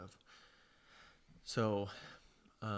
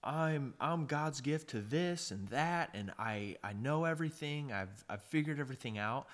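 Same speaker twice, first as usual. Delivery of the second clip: arrogant braggart; 5 semitones higher than usual. Retold or imitated. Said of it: "somebody who's like".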